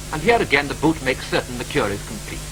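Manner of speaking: ordinary delivery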